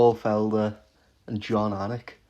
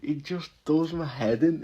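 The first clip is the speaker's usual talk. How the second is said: normal talking voice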